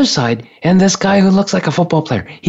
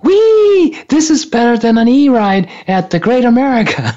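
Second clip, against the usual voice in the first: gleeful squeal; 7 semitones higher than usual. Retold or imitated. Girls on turbulent plane cheering, hammed-up excited squeal. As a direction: play two twelve-year-olds loving the bumpy ride.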